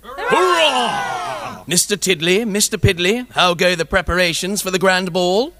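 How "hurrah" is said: hearty royal hurrah; cheering. Acted. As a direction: cheer it out to the crowd. King cheering the crowd.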